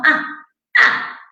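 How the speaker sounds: breathless "ah, ah", half whispered; wordless child grunt. Retold or imitated. Therapist imitating a three-year-old grunting at her mother, unvoiced. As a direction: mimic a toddler grunting for things. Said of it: "agarraba a la mamá y decía".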